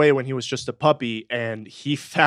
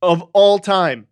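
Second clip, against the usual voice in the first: "Of all time" slow, loud and raised; emphatic reveal; 4 semitones higher, 5 dB louder, slower than usual. Real, not acted. Host confirming the award to co-host, slow and emphatic. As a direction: land each word of "Of all time" slowly.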